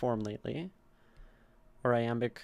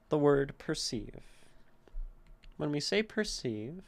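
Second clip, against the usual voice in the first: ordinary talk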